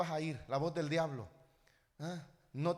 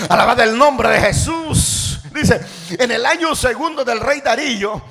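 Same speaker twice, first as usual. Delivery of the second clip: loud, stirred-up sermon voice; fervent preaching; 5 semitones higher, 17 dB louder than usual. Real, not acted. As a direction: build into a forceful proclamation of scripture. (prédica encendida, a plena voz)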